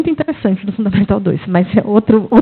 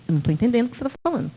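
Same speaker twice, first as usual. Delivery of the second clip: hurried confusion; 8 dB quieter, much faster than usual. Retold or imitated. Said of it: "Eu disse".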